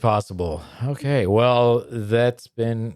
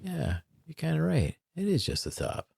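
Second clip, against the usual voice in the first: lightly voiced stoner, higher and hushed; agreeing stoner; 5 semitones higher, 8 dB quieter than usual. Agreeing with a stoned friend, mildly spaced-out and soft. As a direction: softly concede it like a stoned friend. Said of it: "I could see that and be like".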